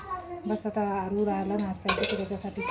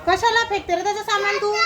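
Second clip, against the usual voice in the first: scolding; 9 semitones higher, 4 dB louder than usual. Real, not acted. Scolding the child.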